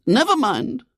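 very high-pitched lady's voice; consoling lady. A narrator's reading. Lady comforts William, consoling and high. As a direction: console the poor child.

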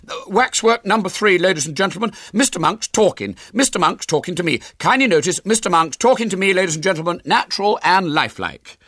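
high, loud showman's patter; big, high-pitched ladies-and-gentlemen voice; young showman William. Acted. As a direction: announce it proudly like a fairground barker. William presents vicar as waxwork.

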